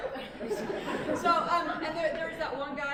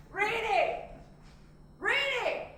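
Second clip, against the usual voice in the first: raised call across the room; 7 semitones higher, much slower than usual. Acted. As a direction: shout a name to get attention. Calling out to someone away.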